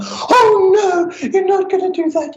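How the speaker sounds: hammed-up horrified cry of no; mock panic. Imitating the panicked counsellor she didn't become, mock alarm.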